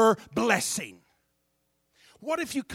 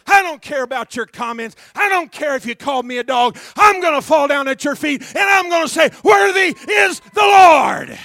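defiant shout; 6 semitones higher, 15 dB louder than usual. Acted. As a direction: build from firm to a full roar. Preaching the woman's defiant words.

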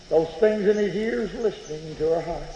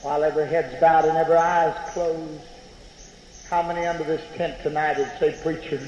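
altar call; 4 semitones lower than usual. Acted. Preacher inviting the tent congregation to pray, hushed altar call. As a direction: call the tent to bow heads.

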